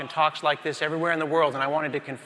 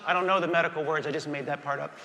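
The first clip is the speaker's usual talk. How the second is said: ordinary talk, nothing marked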